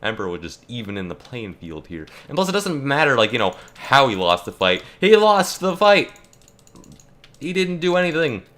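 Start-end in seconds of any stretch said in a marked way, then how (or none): 5.0-8.4 exasperated shout. Real, not acted. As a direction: hammer the point home, fed up. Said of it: "ranting emphasis".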